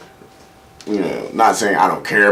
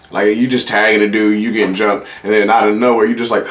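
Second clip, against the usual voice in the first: ordinary talk, nothing marked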